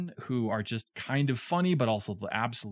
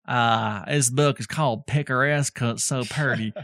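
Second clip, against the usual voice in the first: hammed-up mock Southern accent; terrible Southern accent; 2 semitones lower than usual. Host jokes back to co-host about picaresque, in a mock Southern accent. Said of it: "That was a terrible Southern accent".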